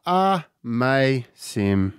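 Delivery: very slow, savouring the name; drawn-out dreamy sigh. Acted. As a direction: linger on it blissfully. Ad, repeated product name.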